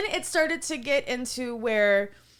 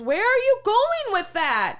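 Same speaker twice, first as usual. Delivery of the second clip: high, loud mock outrage; indignant demand; 11 semitones higher, 7 dB louder than usual. Server mimics diner losing his knife, indignant demand. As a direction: imitate an affronted customer calling someone back. Customer snaps as knife is taken.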